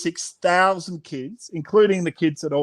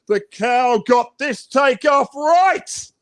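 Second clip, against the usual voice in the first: higher, louder and slow, triumphant; excited; 7 semitones higher, 4 dB louder, slower than usual. Acted. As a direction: cheer the cow's takeoff. Excited storytelling.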